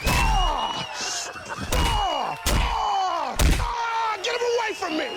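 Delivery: high terrified screams; panicked screaming. Acted. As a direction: scream for your life. Screaming to get him away.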